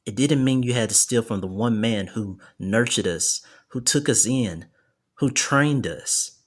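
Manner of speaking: firm, mildly heated, slowing for emphasis at the end; annoyed exclamation. A narrator's reading. Jackson rebukes Ron for stealing, annoyed. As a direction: scold him in an earnest character voice. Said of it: "Jackson, annoyed, exclaims back".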